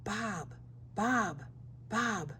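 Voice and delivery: very soft, hushed repeat; whispered. A narrator's reading. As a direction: barely breathe the name. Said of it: "Bob whispered".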